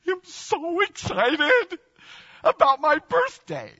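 put-on high, slow, gushing child voice; high child voice bubbling with excitement; excited little girl. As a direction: gush like a thrilled little girl. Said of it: "she's like, she's going".

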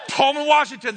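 loud, high shout of a town's name; big hometown cheer; proud announcement. Real, not acted. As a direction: announce it like a grand reveal. Announcing the surprise retirement town.

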